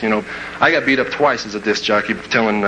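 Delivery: ordinary talk, nothing marked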